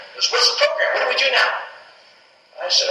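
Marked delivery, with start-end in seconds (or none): 0.3-1.5 much higher, eager and pressing; eager repeat. Retold or imitated. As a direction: push the question back at him with keen energy. Bobby eagerly repeating his question.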